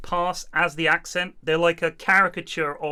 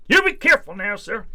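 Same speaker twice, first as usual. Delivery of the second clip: lightly hammed-up cop voice, high and raised; mock American cop; 5 semitones higher, 6 dB louder than usual. Cop to the driver leaving, playful officer voice. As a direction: send him off like a friendly officer.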